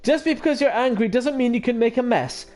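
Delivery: high, stern telling-off; scolding mother. Acted. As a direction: lecture a naughty child. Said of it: "Like Oko-san's mother".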